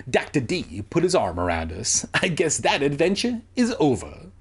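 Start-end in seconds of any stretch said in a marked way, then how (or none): none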